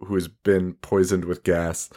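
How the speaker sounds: usual speaking voice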